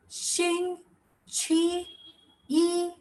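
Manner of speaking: 星, 期, 一 high, with a pause after each; syllable by syllable. Shown as a demonstration. Teacher points out each box, syllable-by-syllable demonstration. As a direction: give 星期一 as three isolated high syllables. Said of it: "If you all can see this box".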